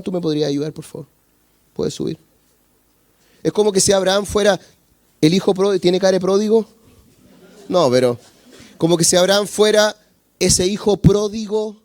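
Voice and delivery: ordinary talk, nothing marked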